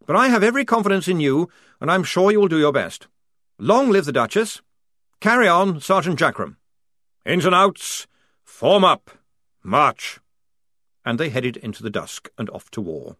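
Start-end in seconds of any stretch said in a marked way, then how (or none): 0.1-10.2 high, quick rousing officer; rally the troops briskly; brisk command. Acted. Lieutenant rallies his men and orders the march, fast.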